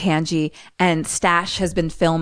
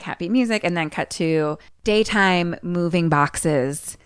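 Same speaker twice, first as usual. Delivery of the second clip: ordinary delivery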